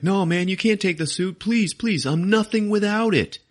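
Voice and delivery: high-pitched mock begging; desperate pleading. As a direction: beg like a panicking kid. Peter begs to keep suit.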